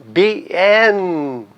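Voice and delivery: beenden drawn out, be-ENN-den; stress on middle syllable. Shown as a demonstration. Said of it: "coloco a sílaba tônica no N".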